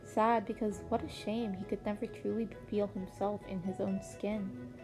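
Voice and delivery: ordinary delivery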